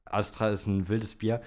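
ordinary talk

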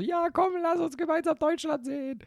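high sing-song mom; high mother voice. Retold or imitated. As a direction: coax like a cheery mother. Mother voiced in family scene.

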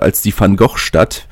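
normal talking voice

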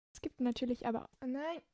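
normal talking voice

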